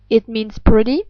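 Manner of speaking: ordinary talk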